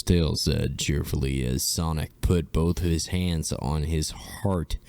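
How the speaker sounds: ordinary talk, nothing marked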